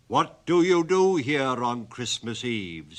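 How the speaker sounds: loud, slow, stern goblin demand. Acted. Goblin sternly questioning the gravedigger, loud and slow. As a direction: bear down on him like a stern goblin. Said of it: "said the goblin sternly".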